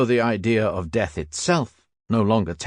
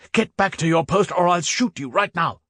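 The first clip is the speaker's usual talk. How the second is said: high threatening yell; angry shout; 9 semitones higher than usual. Acted. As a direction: order him back at gunpoint. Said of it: "shouted the Stubbs".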